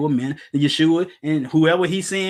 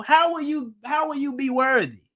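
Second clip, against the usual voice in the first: usual speaking voice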